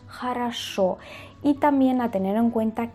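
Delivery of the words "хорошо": хорошо as "ha-ra-SHO"; falling "ha-ra-SHO"; O becomes A. Shown as a demonstration. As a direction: reduce the first two O's of хорошо to A. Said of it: "donde la O pasa a la A".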